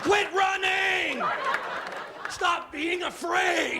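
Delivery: high, slow, laughing shout; frantic shouting. Pretend. Calling after the fleeing woman, high slow laughing shout. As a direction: yell after her, begging. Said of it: "I was like".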